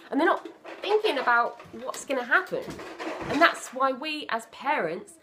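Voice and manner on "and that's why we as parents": normal talking voice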